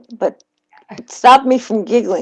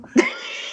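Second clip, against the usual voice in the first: laugh; 4 dB quieter than usual. Real, not acted. Laughing at the grunt.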